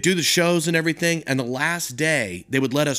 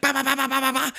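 high mouth-made gunfire; gun noises; 13 semitones higher, faster than usual. Retold or imitated. Nugent firing the gun, high gunfire noises. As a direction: spit out the bursts.

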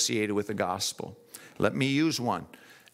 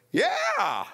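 imitating Antonio. Voicing Antonio's reply.

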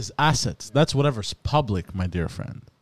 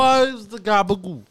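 put-on Sopranos mobster accent; 8 semitones higher than usual. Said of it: "Sopranos movie".

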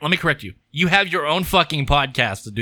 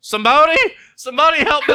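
mock alarmed call; 14 semitones higher, 9 dB louder, faster than usual. Mock panic calling for help.

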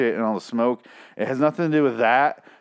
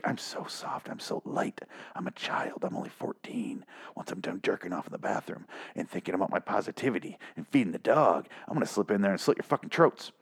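soft menacing whisper; hushed mock-sinister threat; creepy whisper; 6 semitones lower, 10 dB quieter than usual. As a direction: breathe it like a child killer at the door. Creepy son threatens parents.

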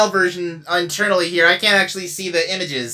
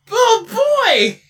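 excited; 11 semitones higher, 3 dB louder than usual. Acted. Delighted at being called special.

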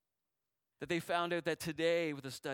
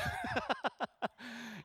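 brief laugh; 8 semitones higher than usual. Real, not acted. Laughs before a welcome.

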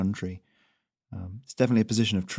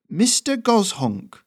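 very high, slow, drawn-out mock title; Ferrino teasing; 11 semitones higher, 5 dB louder, slower than usual. Messenger slowly mocks the goose's name, very high. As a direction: drag out the silly name.

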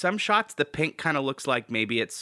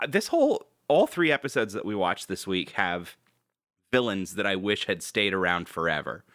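ordinary delivery

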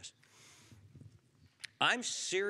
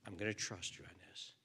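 ordinary delivery

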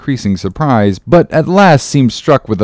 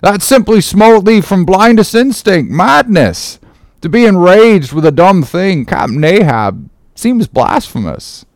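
high, appalled protest; protesting cry; 6 semitones higher than usual. Acted. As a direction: object urgently to the captain. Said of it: "cried Starbuck".

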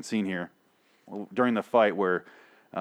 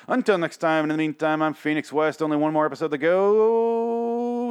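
higher, louder showman sign-off; sign-off announcer; 7 semitones higher, 4 dB louder than usual. Real, not acted. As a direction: sign off like a TV host. Signing off the show.

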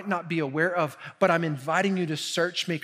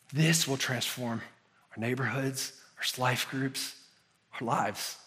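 hushed sincere appeal; 6 semitones lower, 6 dB quieter than usual. Acted. Soft intimate stretch of the sermon.

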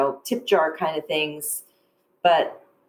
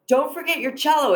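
smug joke; 8 semitones higher than usual. Retold or imitated. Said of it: "I thought I was really clever".

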